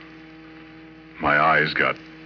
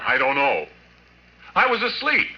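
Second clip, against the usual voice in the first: rattled protest; 10 semitones higher than usual. Acted. Interrogated by police.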